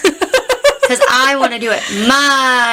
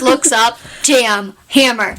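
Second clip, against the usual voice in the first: usual speaking voice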